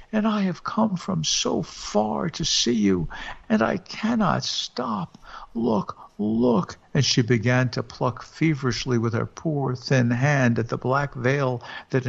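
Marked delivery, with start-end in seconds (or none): 0.1-6.8 high, slow pleading ghost voice, partly whispered; ghostly wail. Acted. Dead wife urges husband to look at her, high ghostly wail. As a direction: urge him to look as a spirit short of time. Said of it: "wailed the voice".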